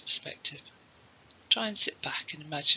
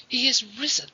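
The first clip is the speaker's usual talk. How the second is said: high-pitched, elated cry; overjoyed, voice lifting high; joyful realisation; 6 semitones higher, 4 dB louder than usual. Acted. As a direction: exclaim it with rising joy. Mary realises her son is alive.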